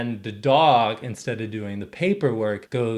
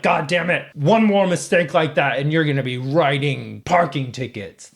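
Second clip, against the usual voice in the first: raised, louder mock reprimand; angry police chief; 3 semitones higher, 3 dB louder than usual. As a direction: threaten a subordinate as the blustering chief. Retold police chief threatening demotion.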